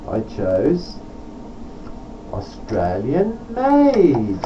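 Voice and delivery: ordinary delivery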